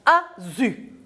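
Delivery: "azu" as a-zu, voiced z. Shown as a demonstration. Teacher reads the last example aloud, "azu" with voiced z. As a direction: read "azu" with a buzzing z. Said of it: "En lisant".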